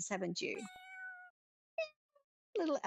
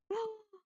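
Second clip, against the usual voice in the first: surprised gasp; 11 semitones higher than usual. Acted. Surprised reaction.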